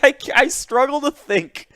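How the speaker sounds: talking through laughter; giggling while teasing a friend; laughing at a clumsy sentence. Real, not acted. Laughing at bad wording.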